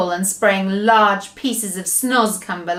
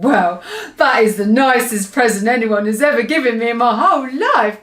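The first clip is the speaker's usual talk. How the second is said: delighted cry; 5 dB louder than usual. Acted. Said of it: "cried the B F G".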